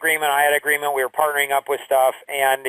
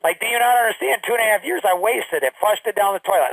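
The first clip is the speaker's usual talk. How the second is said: raised voice; 6 semitones higher than usual. Retold or imitated. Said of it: "like, I raised my voice".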